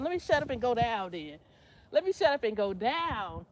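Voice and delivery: usual speaking voice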